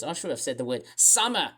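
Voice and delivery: ordinary talk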